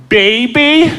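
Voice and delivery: put-on high innocent toddler question; toddler asking. Son points at dad's gut, high innocent question. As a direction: ask it like a curious little kid.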